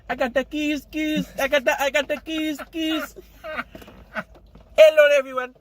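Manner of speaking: high put-on character voice for Khalil; hammed-up high character voice. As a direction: slip into the Khalil voice. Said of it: "I can't do it".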